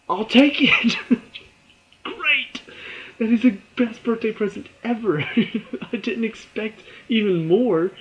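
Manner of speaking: high, laughing delight; laughing glee. Real, not acted. Vlogger gushing about the surprise ending, laughing and high. Thrilled by bonus.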